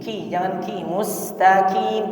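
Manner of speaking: ordinary delivery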